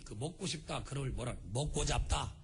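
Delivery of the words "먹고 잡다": Jeolla dialect, saying 잡다 for 싶다; a quick demo of Jeolla regional speech. Shown as a demonstration. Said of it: "그 전라도 사투리". (전라도 사투리로)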